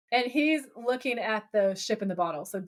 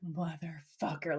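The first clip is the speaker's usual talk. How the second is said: 1 silent curse; 4 semitones lower, 7 dB quieter, slower than usual. Retold or imitated. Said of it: "silently think that word very loudly".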